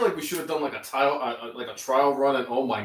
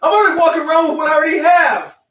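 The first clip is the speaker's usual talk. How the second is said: panicked protest; 9 dB louder, faster than usual. Real, not acted. Panicked refusal of another strip.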